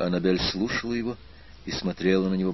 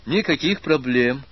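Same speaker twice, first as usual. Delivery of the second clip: higher, louder, satisfied assurance; overly cheerful approval; 6 semitones higher, 5 dB louder than usual. A narrator's reading. American spy agrees to finance the aspirant, too cheerfully. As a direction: approve a little too gladly, pitch lifted. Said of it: "как-то чересчур радостно, удовлетворённо и вообще чересчур одобрительно".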